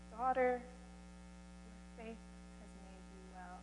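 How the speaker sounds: Jesus blessing the kneeling woman, very soft and slow; tender blessing. Acted. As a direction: breathe it gently to her.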